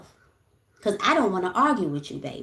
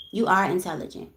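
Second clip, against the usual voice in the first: ordinary talk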